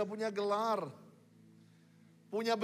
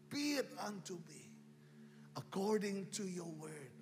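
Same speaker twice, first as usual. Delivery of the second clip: soft surrendered prayer; 7 semitones lower, 11 dB quieter than usual. Shown as a demonstration. Preacher models a quiet prayer of surrender for the congregation. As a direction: pray it softly, giving yourself up. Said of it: "tapi berkata".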